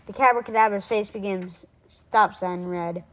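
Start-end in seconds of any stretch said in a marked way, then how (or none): none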